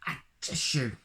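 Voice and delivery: high squeaky fake sneeze; mock sneeze. A narrator's reading. Reading Mister Noisy's sneeze to children, a mock sneeze. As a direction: act out a big sneeze.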